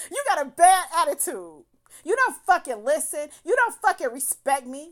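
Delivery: loud, punched mock tirade; retold yelling. Woman mimicking the man berating her, loud mock yelling.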